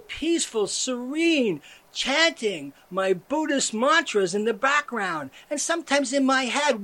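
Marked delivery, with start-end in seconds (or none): none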